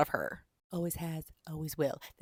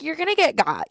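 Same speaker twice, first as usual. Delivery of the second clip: mock threat; 11 semitones higher, 9 dB louder than usual. Voices heroine's threat to kidnapper.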